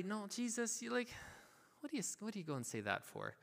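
hushed, dismayed objection; acted protest. Believer objects to Jesus's hard words, softly acted protest. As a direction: protest under your breath, half-joking. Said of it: "I hear that and I wanna say".

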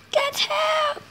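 high, crying plea. Acted. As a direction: plead urgently, voice up. Urgent plea.

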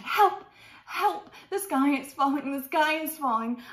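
high, loud cartoon alarm; panicked cry for help. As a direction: call out in mock panic. Chick cries for help, alarmed.

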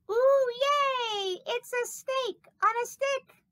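high, delighted squeal of admiration; excited crowd cheer. Pretend. Snake imagines people admiring it, high excited cheer. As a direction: cheer it like an adoring fan. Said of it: "and everyone would say".

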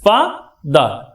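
slow, split into two beats; syllable by syllable. Shown as a demonstration. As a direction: say each syllable apart, slowly. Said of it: "দুটি vowel সাউন্ডের দুটি অংশ হয়".

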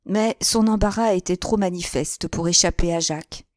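usual speaking voice

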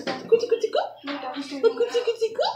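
high, soft sing-song coo. Real, not acted. Mother coos at baby.

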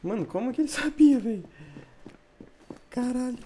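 high, loud cry of disbelief; shocked outburst. Real, not acted. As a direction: yelp it in shock. Shocked at opponent's play.

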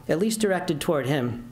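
plain reading voice

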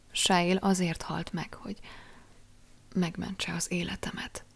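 Viktória tells Jack of Shail's death, whispering; said softly. Acted. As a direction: barely breathe the words out. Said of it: "mondta végül halkan".

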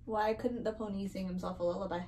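usual speaking voice

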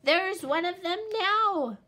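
high, loud shout. A narrator's reading. Little Wolf to his parents, triumphant shout. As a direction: shout to prove he's right. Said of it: "Little Wolf shouted".

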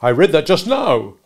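protesting witness; pleading. Acted. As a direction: plead in your defence. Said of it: "Mister Wopsle pleaded".

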